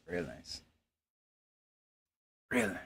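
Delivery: whisper. Real, not acted. Muttered while hiding.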